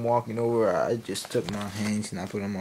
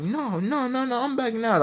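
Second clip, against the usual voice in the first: high, loud mock tantrum; Philip; 13 semitones higher, 4 dB louder than usual. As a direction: refuse in a squealing huff. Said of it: "And Philip got mad".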